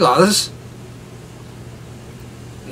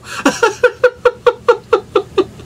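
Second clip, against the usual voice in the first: long high breathless laughter; helpless real laughter; laughing fit. Real, not acted. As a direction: lose it completely.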